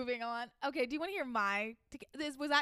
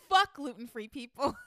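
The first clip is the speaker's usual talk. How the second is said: slow, punched curse; angry celebrity; 5 dB louder than usual. Acted. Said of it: "the celebrity said".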